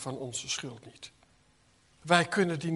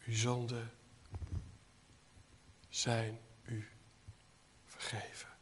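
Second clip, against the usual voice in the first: softer and lower, ending unvoiced; fading to a whisper; 6 semitones lower, 8 dB quieter than usual. Real, not acted. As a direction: let the promise fade into a whisper. Repeating assurance ever softer. (wegstervend tot gefluister)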